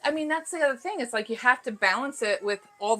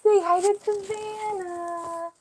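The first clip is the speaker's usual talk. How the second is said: excited mom; 12 semitones higher, 9 dB louder than usual. Real, not acted. Mom announces her baby daughter is awake, high delighted coo.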